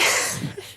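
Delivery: airy giggle with no voice; breathy laugh. Real, not acted. Speaker amused by own Latin, breathy laugh.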